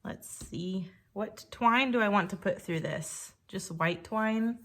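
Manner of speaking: normal talking voice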